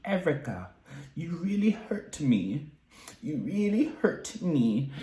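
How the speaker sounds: high, slow and hurt, a soft plea; Dorit impression. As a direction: act out Dorit's wounded complaint. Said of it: "this is my best Dorit impression".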